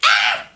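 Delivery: sharp startled shriek; startled yelp. Retold or imitated. Mom at the net yelps as the ball comes. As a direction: yelp in sudden fright at the ball. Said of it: "and I do this".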